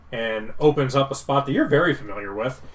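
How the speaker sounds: ordinary talk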